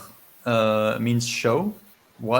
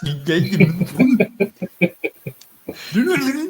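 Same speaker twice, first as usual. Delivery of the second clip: high, haughty French pronunciation; snooty French; 9 semitones higher, 7 dB louder, faster than usual. Pretend. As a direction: say it like a snob in a boutique. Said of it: "you have to say it in a really snooty, snooty voice".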